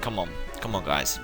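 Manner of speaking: ordinary delivery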